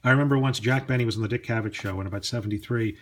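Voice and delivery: ordinary talk, nothing marked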